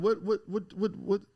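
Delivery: normal talking voice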